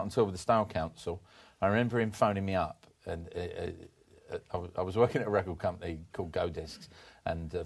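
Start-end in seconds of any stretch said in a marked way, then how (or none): none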